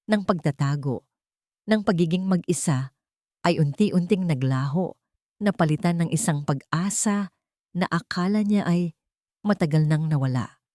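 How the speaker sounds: ordinary delivery, nothing marked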